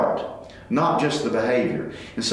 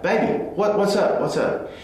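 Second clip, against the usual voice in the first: daughter voice; 6 semitones higher than usual. Retold or imitated. Daughter removes earbud, answers dad.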